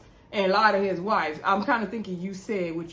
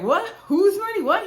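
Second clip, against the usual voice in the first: high-pitched, louder outraged questions; mock incredulous; 11 semitones higher, 4 dB louder than usual. Blogger retells Anthony hearing the dad's-money jab, incredulous. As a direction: imitate his indignant disbelief, voice jumping up high. Acting out indignant retort in retold quarrel.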